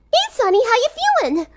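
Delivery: very high, bright, bouncy greeting; high sing-song party girl; chipper. A narrator's reading. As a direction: cheer up a sick friend. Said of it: "in her chipper voice".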